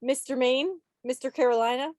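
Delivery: ordinary delivery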